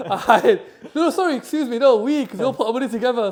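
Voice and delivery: loud, laughing apology. Real, not acted. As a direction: laugh through the correction. Laughing correction to the lads.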